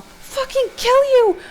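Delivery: very high, loud, drawn-out yell; furious yell. A narrator's reading. Hero raising knife over Rick, furious yell. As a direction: scream it slowly with all your rage. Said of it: "and yell".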